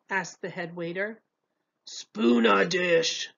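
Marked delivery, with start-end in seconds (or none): 1.8-3.3 slow, odd creature voice; monster voice. A narrator's reading. Hungry thing ordering food from the head waiter, creature voice. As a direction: drag out the strange dish name. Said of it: "said the hungry thing".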